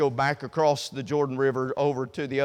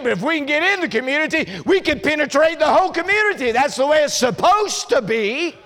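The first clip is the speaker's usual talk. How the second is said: loud, much higher, fast preaching; full-force preaching; 10 semitones higher, 6 dB louder than usual. Acted. Preacher to congregation, fired up about the church as leaven. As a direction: drive it home to the congregation.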